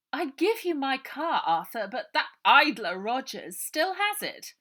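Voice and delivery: honey-sweet voice hiding malice; treacly Missus Buller. A narrator's reading.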